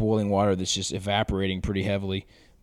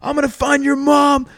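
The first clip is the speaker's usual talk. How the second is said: retold drugged scream, much higher and louder; high, loud mock scream at the surgeon; 10 dB louder than usual. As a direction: yell the threat like a doped-up patient losing it. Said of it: "screaming at him".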